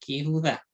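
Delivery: Yehudá said as "kihudá", final syllable stressed; demonstrated pronunciation. Shown as a demonstration. Teacher summing up the rule, Yehudá as "kihudá". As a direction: model Yehudá with an opening "ki". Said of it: "Ella se va a pronunciar".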